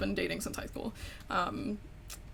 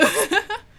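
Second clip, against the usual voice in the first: laugh; 10 semitones higher, 8 dB louder than usual. Real, not acted. Laughing at start.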